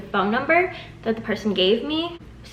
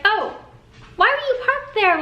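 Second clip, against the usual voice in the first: high, sing-song mock of the other driver; a hammed-up high voice aimed at the teller for parking there; mocking the lady; 12 semitones higher than usual. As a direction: play her as prissy and accusing, voiced well up high. Lady blaming the teller for the crash.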